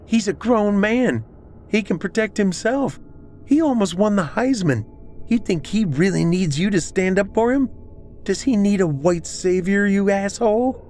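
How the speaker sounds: very high-pitched indignant tirade; angry shouting. A narrator's reading. Celeste telling Pat that Trey can protect himself, angry. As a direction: lash out in a high, strained voice. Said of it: "she shouted".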